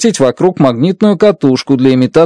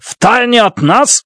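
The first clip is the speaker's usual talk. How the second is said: mild indignant outburst, higher, slow; lightly voiced angry outburst; flaring up; 7 semitones higher, slower than usual. A narrator's reading. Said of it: "вспылил Фокт".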